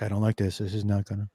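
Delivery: low, soft fretting under the breath; mock nervous kid, low and hushed; imitated worried grumbling. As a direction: fret quietly to yourself. Acted nervous kid grumbling along.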